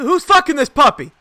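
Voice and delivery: high, loud indignant yell; outraged shout. Real, not acted. Streamer yells at the game, high outraged shout. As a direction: bellow it in disbelief. Outburst during match.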